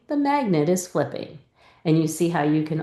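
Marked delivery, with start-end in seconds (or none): 0.1-1.4 smooth reread. Shown as a demonstration. Teacher modelling a student's fluent reread, smooth. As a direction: read the sentence as one smooth line. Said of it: "now read me the whole".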